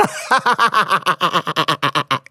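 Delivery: long, softening run of laughter; helpless laughter. Real, not acted. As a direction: crack up mid-reading. Cracks up reading about a loan.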